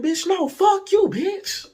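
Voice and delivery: very high, slow mock outrage; throwing curses back. Storyteller escalates cussing match with date, hammed-up outrage. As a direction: stretch out a squealed, hammed-up comeback. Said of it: "literally throwing fuck yous at each other".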